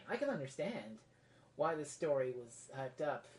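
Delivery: normal talking voice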